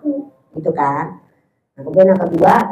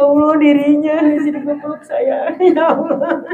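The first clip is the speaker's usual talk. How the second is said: laughing Ya Allah, voice up high; 7 semitones higher, 3 dB louder than usual. Real, not acted. Preacher moved by a sad question, laughing.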